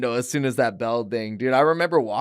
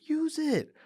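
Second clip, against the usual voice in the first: high, softer coaching; soft coach advice; 3 dB quieter than usual. Retold or imitated. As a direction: coax him gently between rounds. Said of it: "He goes".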